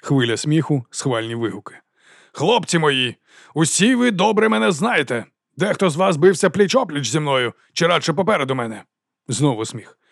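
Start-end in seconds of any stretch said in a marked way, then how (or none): 2.3-8.8 raised, rallying speech to soldiers; ringing oration. A narrator's reading. Captain flatters his old comrades, ringing oratory. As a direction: hold forth to the crowd. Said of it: "Його голос дзвенів".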